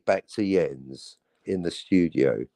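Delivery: ordinary delivery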